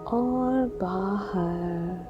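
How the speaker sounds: very slow, stretched vowels; drawn-out exhale cue. Acted. Guide pacing the listener's out-breath, drawn-out exhale cue. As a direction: draw each word out to last a whole out-breath.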